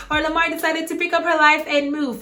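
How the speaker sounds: high voice of mock surprise; mock shock. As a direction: keep the comic shocked tone. Continues the shocked imagined voice.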